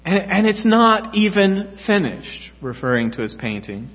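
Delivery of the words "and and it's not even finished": tearful protest. A narrator's reading. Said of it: "beginning to weep".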